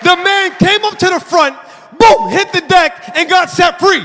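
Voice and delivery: high, punchy climax of a testimony; triumphant preaching. Real, not acted. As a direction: proclaim the ending with full force.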